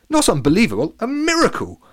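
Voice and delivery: high, loud, delighted; joyful correction. A narrator's reading. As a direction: crow it with glee. Stan answers Latham joyfully.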